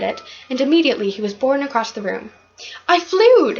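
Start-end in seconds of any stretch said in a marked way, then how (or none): 2.9-3.6 high, loud cry of joy in midair; excited scream. A narrator's reading. Michael shouting as he flies for the first time, joyful scream. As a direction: shriek it with pure delight. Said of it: "he screamed".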